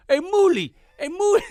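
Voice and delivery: high, loud Italian-American hollering; shouted Italian-American greeting; Italian tough guy. Retold or imitated. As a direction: call out like a brash Italian guy from the movies. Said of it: "He goes".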